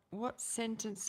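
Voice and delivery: ordinary talk, nothing marked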